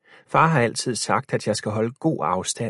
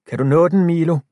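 raised voice, higher and louder; calling out; 7 semitones higher, 7 dB louder, faster than usual. A narrator's reading. Boy calling to Milo who reaches for the phone. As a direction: call out to his friend on the wall.

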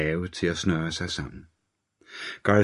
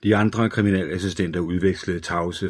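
usual speaking voice

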